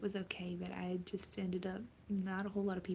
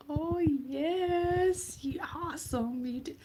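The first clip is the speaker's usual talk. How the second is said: higher and louder burst of delight; light up at a viewer's gift; excited; 8 semitones higher, 9 dB louder, much slower than usual. Real, not acted. Reacting to a viewer comment.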